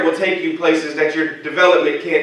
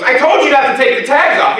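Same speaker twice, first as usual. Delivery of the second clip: scolding mother; 9 semitones higher, 3 dB louder than usual. Retold or imitated. Wife scolding son over tags.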